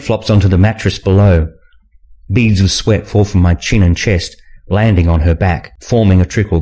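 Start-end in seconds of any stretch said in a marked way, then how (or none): none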